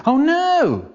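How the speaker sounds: high, drawn-out exclamation; mock dismay. Pupil finding a classmate on the floor, dismayed. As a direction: wail it in exaggerated dismay.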